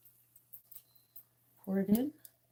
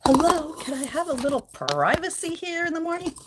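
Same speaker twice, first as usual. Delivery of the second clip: higher put-on voice; higher, louder fish demanding privacy; indignant fish voice; 8 semitones higher, 6 dB louder than usual. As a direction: play the huffy fish. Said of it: "He's like".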